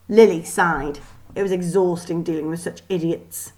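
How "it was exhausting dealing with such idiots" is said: usual speaking voice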